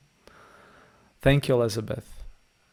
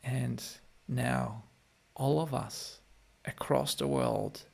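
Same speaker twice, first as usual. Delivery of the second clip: guided meditation; 9 dB quieter than usual. Acted. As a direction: gently ease the listeners back into their bodies. Leads a closing guided meditation.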